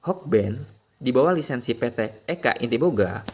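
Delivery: usual speaking voice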